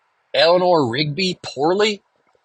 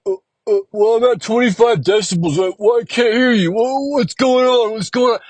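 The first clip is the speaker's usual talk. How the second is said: higher, whiny imitation of the sound guy; mocking sound guy; 8 semitones higher than usual. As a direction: mimic the clueless sound guy, high and pinched. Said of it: "he's like".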